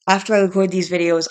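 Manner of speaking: usual speaking voice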